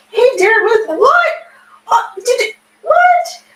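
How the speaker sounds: real high squeal of surprise; shocked squeal. Real, not acted. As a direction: squeal out in disbelief at a new follower. Surprised by a follow and rant.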